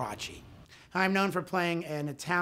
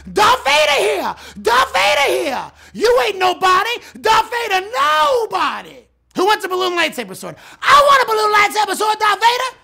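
put-on kids screaming; 13 dB louder than usual. Kids mobbing the party Vader, screaming high and loud. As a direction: scream it like a mob of excited kids. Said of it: "all running towards me screaming".

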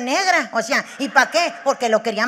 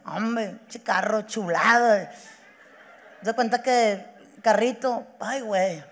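mock tearful; 3 semitones lower than usual. [name] mourning his first car to a friend, mock tearful. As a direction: play it choked up, halting, sinking lower. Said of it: "con ojos llorosos".

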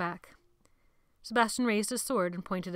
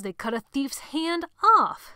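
menacing emphasis; 6 semitones higher, 8 dB louder, slower than usual. A narrator's reading. Menacing threat to boys.

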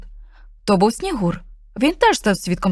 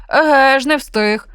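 dog growls; 4 semitones higher, 5 dB louder, slower than usual. Acted. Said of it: "загарчав пес".

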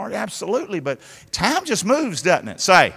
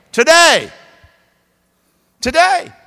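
preaching shout; 10 semitones higher, 6 dB louder than usual. Real, not acted. Preacher answer to call.

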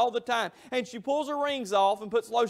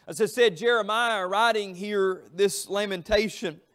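ordinary delivery

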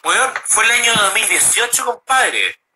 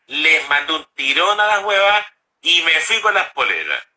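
ordinary delivery

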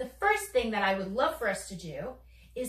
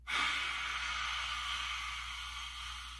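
long, soft unvoiced exhale; long dragon breath; 7 dB quieter than usual. Shown as a demonstration. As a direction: breathe fire out slowly. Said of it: "Dragon breath".

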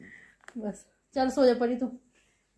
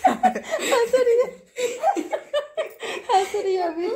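high-pitched laugh breaking through the words; 6 dB louder, slower than usual. Real, not acted. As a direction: laugh out loud while saying it. Laughing at the dog's play. (हंसते हुए ऊँची आवाज़ में)

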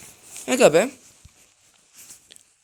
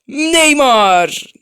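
high, loud cry of the name; loud shout; 8 semitones higher, 12 dB louder than usual. Real, not acted. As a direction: yell it out. Loud call of player.

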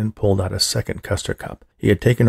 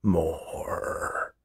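low, much softer, dying murmur; last faint sound; 4 semitones lower, 9 dB quieter than usual. A narrator's reading. Unconscious old man sighing out one last more. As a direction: push the word out with the last breath. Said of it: "one last sound pushed its way out".

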